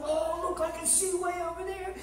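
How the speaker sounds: happy amazement. Acted. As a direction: play delighted surprise at new sight. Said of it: "he's really happy".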